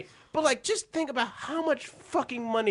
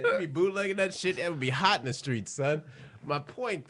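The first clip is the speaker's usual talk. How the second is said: normal talking voice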